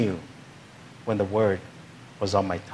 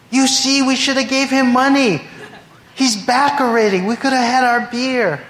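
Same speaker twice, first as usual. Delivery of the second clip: a much higher, hammed-up tipsy neighbor grumbling; 11 semitones higher than usual. Neighbors at a tailgate grumble about the beer, in a high mock tipsy voice. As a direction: play the buzzed neighbor moaning about the missed beer. Drunk neighbors complain among themselves.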